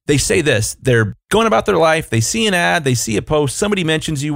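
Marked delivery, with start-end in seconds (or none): none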